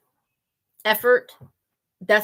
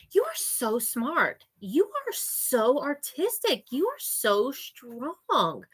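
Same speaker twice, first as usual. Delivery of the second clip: slow sing-song praise; 4 semitones higher, 6 dB quieter, slower than usual. Retold or imitated. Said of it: "but, like".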